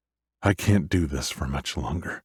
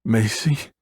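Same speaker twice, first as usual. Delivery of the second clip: cracking, higher, tearful; voice breaking; 6 semitones higher than usual. Acted. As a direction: let the voice break on the name. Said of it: "My voice breaks".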